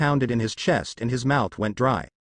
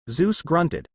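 ordinary delivery

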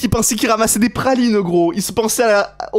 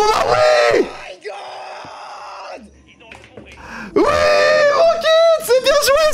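real ecstatic screaming, much higher pitch; hurlement de joie; 8 semitones higher, slower than usual. Real, not acted. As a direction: yell the victory out, stretching the words. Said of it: "je suis en train de gueuler". (hurler de joie, voix très aiguë)

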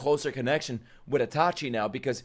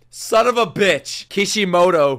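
slow, loud raised-pitch curse; angry cursing; 5 semitones higher, 6 dB louder, slower than usual. Real, not acted. Fan cursing author Kishimoto, angry cursing. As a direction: spit out the curse, stretched and loud.

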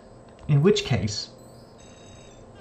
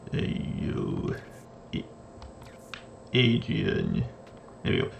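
low mumbled Rocky Balboa mumble; a lightly done Stallone mumble; Stallone impression; 4 semitones lower, 8 dB quieter than usual. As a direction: mumble like Rocky, barely voiced. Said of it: "That's a terrible, terrible Stallone impression".

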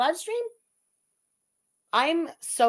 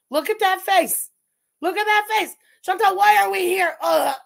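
disgusted outcry; 8 semitones higher, 7 dB louder than usual. Real, not acted. Reacting to a disgusting face.

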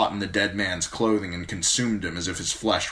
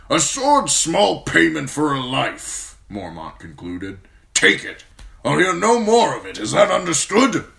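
old commander voiced higher and louder, blunt and forceful; a gruff old commander praising a young man; gruff old lord commander; 10 semitones higher, 9 dB louder than usual. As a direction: speak as the stern old Lord Commander, grateful but brusque. Mormont voice again.

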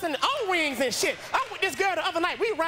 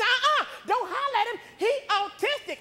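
higher-pitched scolding woman; protective mother; 7 semitones higher than usual. Retold or imitated. Mother defending her son to her boyfriend, high-pitched scolding.

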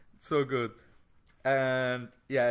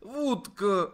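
put-on Russian accent; 7 semitones higher, 5 dB louder than usual. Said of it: "This is my really offensive Russian voice".

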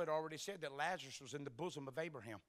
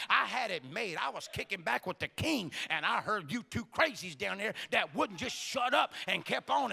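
indignant Lazarus scolding; 7 dB louder than usual. Acted. Lazarus scolding sisters who called him back.

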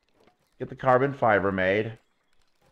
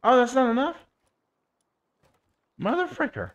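annoyed surprise; 10 semitones higher, faster than usual. Real, not acted. Short of crafting materials.

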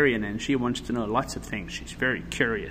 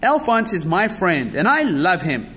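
put-on raised, emphatic child's declaration; louder, insistent protest; heartfelt cry; 7 semitones higher, 6 dB louder than usual. A narrator's reading. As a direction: cry out loyally for a friend. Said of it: "cried Louis".

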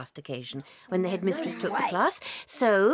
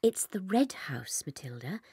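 slow, hushed whisper of a dark secret; 4 semitones lower, 6 dB quieter than usual. Acted. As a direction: slowly whisper it like a confession. Revealing the aunt's house.

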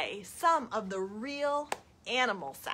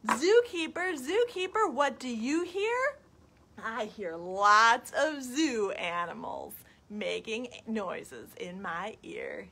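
put-on reading to children; 2 semitones higher, 4 dB louder, slower than usual. Ending the zoo story for children, lilting. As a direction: chant the question, ease down to the finish. Reads picture book to children.

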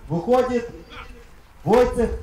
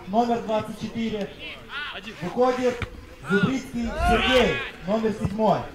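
echoing stadium announcer; 7 semitones higher than usual. Acted. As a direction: read it like a stadium speaker.

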